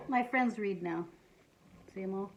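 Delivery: usual speaking voice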